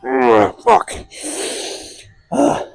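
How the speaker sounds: grunting through the strain; lifting weights. Real, not acted. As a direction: force it out mid-rep. Straining on last reps.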